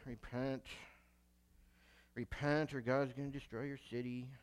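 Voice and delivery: low, very quiet half-hearted warning; grudging, subdued announcement; reluctant prophet. Acted. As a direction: mumble the warning like you don't want to give it. Jonah reluctantly delivers his message.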